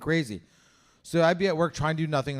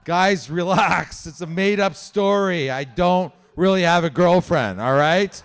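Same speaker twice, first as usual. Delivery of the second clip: slow reassurance; 7 dB louder, slower than usual. Real, not acted. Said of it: "slow reassuring to crowd".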